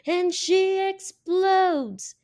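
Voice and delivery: high, drawn-out dramatic cry; explosive outburst. A narrator's reading. Narrator tells of the girl losing her temper, explosive. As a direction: stretch it out high like a blow-up.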